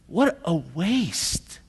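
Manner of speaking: slow, drawn-out disapproval; mad. Acted. Disciples repeating their verdict on Mary, indignant. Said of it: "No, they were mad".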